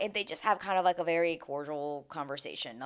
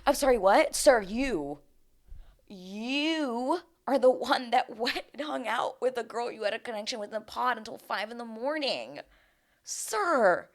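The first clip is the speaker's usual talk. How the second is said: incredulous sass; 6 semitones higher than usual. Retold or imitated. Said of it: "I'm like".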